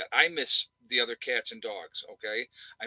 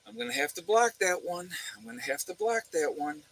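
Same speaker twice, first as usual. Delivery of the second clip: higher, softer voiced thought; mock musing to oneself, lightly pitched up; retold musing; 7 semitones higher, 6 dB quieter than usual. Voices his own earlier thought.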